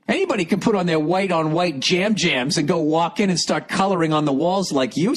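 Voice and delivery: ordinary talk, nothing marked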